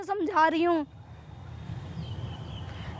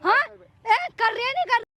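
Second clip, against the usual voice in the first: higher-pitched angry ranting; furious threats; 5 semitones higher than usual. Acted. Daughter-in-law threatening mother-in-law over property, furious and high.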